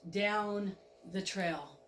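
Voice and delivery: ordinary delivery, nothing marked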